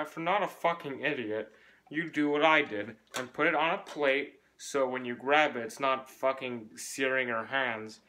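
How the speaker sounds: usual speaking voice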